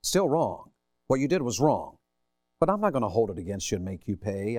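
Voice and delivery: ordinary talk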